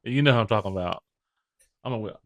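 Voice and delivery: very soft, secretive aside; hushed confiding. Real, not acted. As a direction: drop to a near whisper. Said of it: "I had to whisper it".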